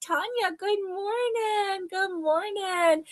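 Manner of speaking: high, slow, drawn-out good morning; singsong greeting. Real, not acted. Host greeting a viewer who just joined, singsong. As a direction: coo a sweet hello.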